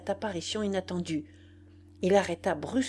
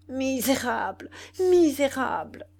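raised, higher-pitched cry; outraged exclamation; indignant outcry; 6 semitones higher, 3 dB louder than usual. A narrator's reading. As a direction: cry out the accusation, pitch lifted. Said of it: "m'écriai-je".

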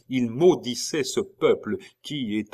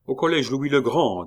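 higher, louder order to the coachman; calling out; 5 semitones higher, 5 dB louder than usual. A narrator's reading. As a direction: call out the destination.

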